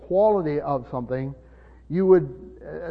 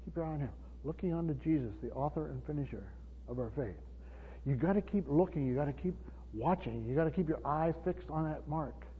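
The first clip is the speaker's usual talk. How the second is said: much softer, intimate and urgent; hushed exhortation; 9 dB quieter than usual. Real, not acted. Pastor urging the congregation to keep watching, hushed. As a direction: draw them in close and plead.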